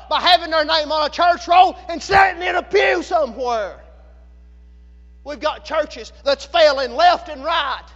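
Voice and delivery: loud, indictment-style sermon; full-force preaching. Real, not acted. Preacher explains why churches are failing, in loud hard preaching. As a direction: hammer the question and its answer.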